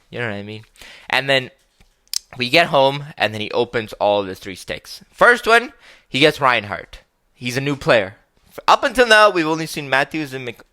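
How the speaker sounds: ordinary talk, nothing marked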